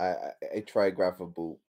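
usual speaking voice

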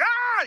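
high short yelp to wake up; sudden high mock scream; mock yell. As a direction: yelp as if shaking off sleep. Screaming to stay awake.

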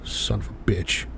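low, soft, defeated swearing; weary muttered curse. Real, not acted. Host fed up with his show, quiet and weary. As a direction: curse under your breath after a sigh.

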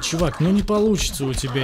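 ordinary delivery

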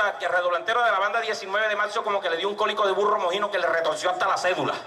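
normal talking voice

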